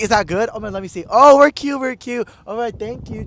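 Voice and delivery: put-on high, excited quoted girl voice. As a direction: imitate her delight at the selfie. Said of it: "and she's like".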